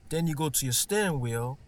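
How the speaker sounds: normal talking voice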